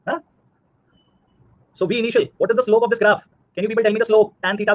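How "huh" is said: teasing. Real, not acted. Teasing students, easy vs difficult.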